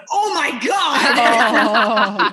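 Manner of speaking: slow, laughing "oh my God"; overwhelmed, laughing in awe; breathless amazed gasp; stunned disbelief. Retold or imitated. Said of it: "And I went".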